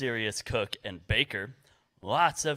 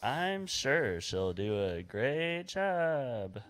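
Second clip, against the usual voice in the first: slow, drawn-out assurance; insincere praise; much slower than usual. Acted. Realtor backs a rival for the buyers, insincere praise. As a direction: stretch every word out in doubtful praise.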